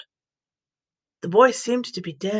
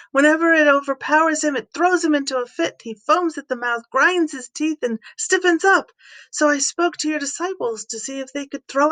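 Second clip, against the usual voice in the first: a father's higher, pleading voice, pitched up; desperate father; 5 semitones higher than usual. Acted. Father begging the teacher to heal his son, pleading high. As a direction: beg the teacher to save your son.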